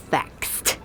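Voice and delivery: "faxed" slowly, ending in a crisp t. Shown as a demonstration. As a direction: finish "faxed" on kst. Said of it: "with the T".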